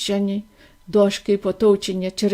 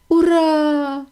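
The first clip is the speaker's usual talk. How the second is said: long, higher-pitched hooray; joyful cry; 6 semitones higher than usual. A narrator's reading. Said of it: "закричав дідунь Джо". (протяжний радісний вигук)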